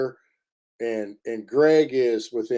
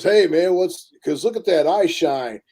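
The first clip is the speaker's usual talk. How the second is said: higher and louder, an urgent excited call to a friend; 6 semitones higher, 5 dB louder than usual. Retold or imitated. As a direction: blurt it out, startled by the eye shine. Greg spotting eye shine, excited.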